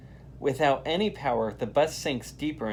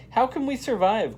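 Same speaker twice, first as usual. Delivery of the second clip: a high, louder cry of worry; frightened cry; 10 semitones higher, 5 dB louder than usual. A narrator's reading. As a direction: ask it in a panic. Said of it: "cries Jyoti".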